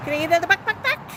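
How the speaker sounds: high plea climbing into clucks; coaxing clucks. Pretend. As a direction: egg the bird on with chicken noises. Coaxing the bird for more.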